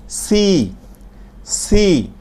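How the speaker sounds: সি with a sharp hiss of air. Shown as a demonstration. Teacher demonstrating hissing সি to class. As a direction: let the s in সি whistle through the teeth. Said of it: "একটু মুখ দিয়ে শীষ বের হয়ে যাবে".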